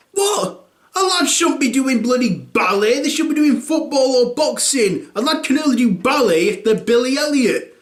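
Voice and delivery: high, scandalised mock outrage at ballet; scoffing dad. Dad scoffing at his son's ballet, mock outrage.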